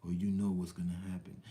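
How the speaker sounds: low, soft menacing voice; a low quiet sinister whisper of a threat; imitated devil threat. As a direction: hiss it like a devil warning. Voicing threatening devil figure.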